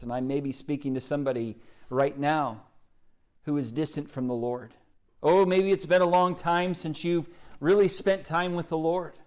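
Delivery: normal talking voice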